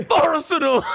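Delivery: Arsenal shouted high and loud like a crazed fan; mimicking his mate's mad Arsenal shout; mock fan yell. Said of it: "sitting there like a complete lunatic".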